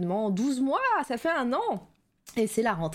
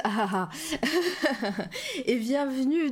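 laughing; 5 semitones higher, much slower than usual. Real, not acted. Laughs while greeting chat.

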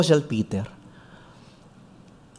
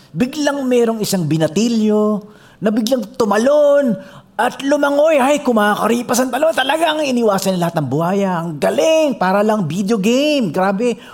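excited narration; 7 semitones higher than usual. A narrator's reading. Preacher narrates the young man's swim, excited. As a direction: tell it like a thrilling race.

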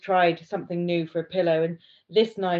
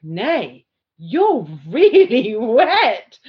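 mock Dan voice, louder, slow and breaking into a laugh; Dan's voice; 4 semitones higher, 10 dB louder, much slower than usual. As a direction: play Dan drawing it out, laughing mid-line. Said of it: "I'm doing this voice for Dan".

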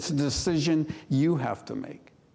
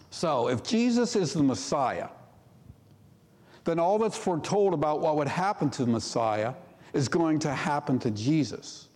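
ordinary talk, nothing marked

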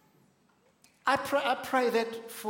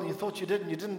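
ordinary delivery

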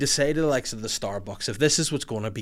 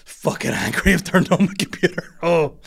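shouty rant; 3 semitones higher, 5 dB louder than usual. Real, not acted. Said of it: "I've shouted so loudly".

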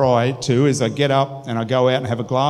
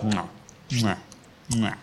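soft mock chewing noises; mock cow chewing; 3 semitones lower, 8 dB quieter than usual. Shown as a demonstration. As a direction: mimic a cow chewing cud. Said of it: "like chewing like those cows".

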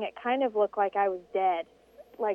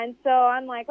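ordinary talk

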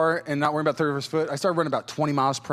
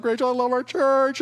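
gushing; 9 semitones higher than usual. Retold or imitated. Crier gushing at a baptism.

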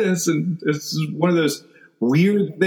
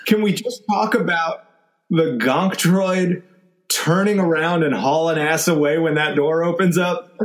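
usual speaking voice

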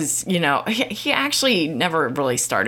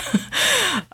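short laugh. Real, not acted. Laughs at own calm.

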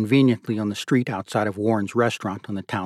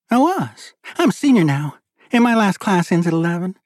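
higher-pitched, proud schoolgirl answer; 8 semitones higher, 4 dB louder than usual. A narrator's reading. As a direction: answer with pride, pitched up high. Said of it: "she answered proudly".

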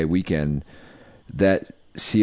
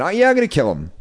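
mock casual threat; 9 semitones higher, faster than usual. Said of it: "going all over town saying".

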